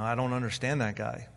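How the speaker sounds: ordinary talk, nothing marked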